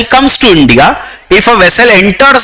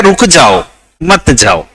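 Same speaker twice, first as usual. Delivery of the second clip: रुक जाओ, then a lower, separate मत जाओ; two commands, the second dropping in pitch; split into two commands; 6 semitones lower, slower than usual. Shown as a demonstration. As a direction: set मत जाओ apart as its own order. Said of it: "उसका ये कहना है कि कानून कह रहा है".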